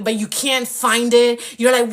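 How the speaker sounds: usual speaking voice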